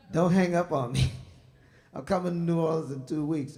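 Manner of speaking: imitating the kid begging, hammed-up; mock pleading shout. Singer begs the teller on the phone not to hang up, loud. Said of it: "He said".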